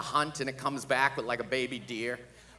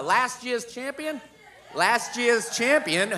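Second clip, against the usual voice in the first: hype; 6 semitones higher, slower than usual. Real, not acted. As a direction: sell him to the crowd. Hyping Oren to crowd.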